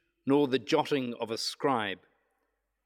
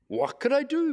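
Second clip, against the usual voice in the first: high-pitched, pleading protest; 12 semitones higher, 3 dB louder than usual. A narrator's reading. Mayor answering the prophet's accusation, pleading. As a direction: plead helplessly, pitched well up. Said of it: "pleaded the mayor".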